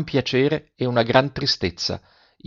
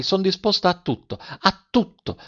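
high, ardent cry; exclaiming; 9 semitones higher, 3 dB quieter than usual. Acted. Silvia hugging her mother, exclaiming higher. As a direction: throw it out, much higher. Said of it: "esclamò".

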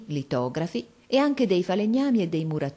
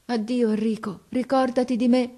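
tearful farewell; 6 semitones higher than usual. A narrator's reading. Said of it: "mi disse all'orecchio".

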